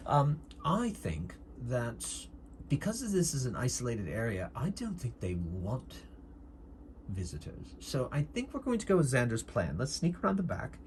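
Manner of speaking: put-on deliberate doctor character in an affected accent; well-spoken doctor with a put-on accent; doctor's put-on accent. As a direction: lay out the plan in an affected accent. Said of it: "sometimes I lose my accent".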